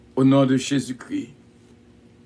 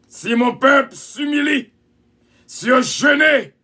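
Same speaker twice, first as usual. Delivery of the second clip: fiery preaching; 7 semitones higher, slower than usual. Acted. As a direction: plead with the congregation, slowly. Preacher pleads for repentance.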